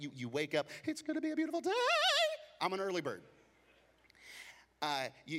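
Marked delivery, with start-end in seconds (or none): 0.8-3.2 much higher, chirpy imitation of a morning person; mock excited early bird. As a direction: play up the over-eager early riser. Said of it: "And you are just excited".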